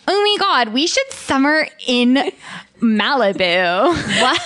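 put-on high, gushing Valley Girl "Oh my God"; Valley Girl catchphrase. Joking that Brits say this, high Valley Girl gush. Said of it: "a very popular catchphrase is".

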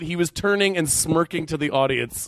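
ordinary delivery

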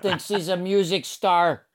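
high mock complaint about a singer; mock scolding. Guest plays someone griping at [name]'s music, mock scolding. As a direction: raise it high like a scolding listener.

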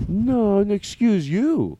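slow, pointed excuse you; slow drawn-out. Acted. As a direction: stretch out each word to mock. Slow mocking comeback.

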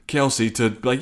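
normal talking voice

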